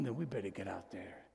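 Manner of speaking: low urgent whisper; whispered urgent charge. Real, not acted.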